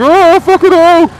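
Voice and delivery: high, loud, stretched panicked shout; terrified yell. Retold or imitated. Dangling over a hundred foot drop, terrified scream.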